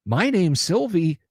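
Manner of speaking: ordinary delivery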